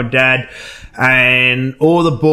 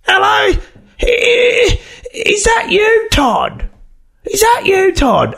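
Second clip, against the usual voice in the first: put-on old auntie voice; much slower than usual. Retelling Auntie Joyce answering the prank call, high shrill-free lady voice. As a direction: imitate the auntie squawking down the phone.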